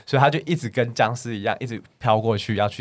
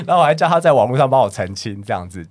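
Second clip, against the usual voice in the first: usual speaking voice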